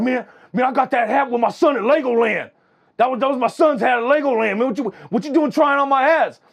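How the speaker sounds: high, loud imitated rant about his son's hat; retold yelling. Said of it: "he starts yelling at this fool".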